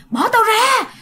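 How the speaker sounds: high, loud pleading yell to be let go; retold desperate yelling. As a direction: shout it like a man being dragged off. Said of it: "Mà anh này cứ la liên tục như vậy". (người chồng la làng bị ma kéo, giọng cao và to)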